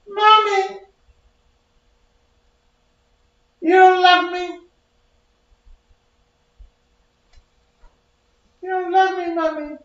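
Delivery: put-on very high, desperate little baby voice; pleading baby voice. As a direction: plead for your life like a tiny baby.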